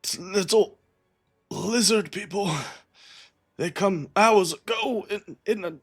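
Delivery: high, weak voice of a dying man; gasping out what he saw; wounded dying man. Acted.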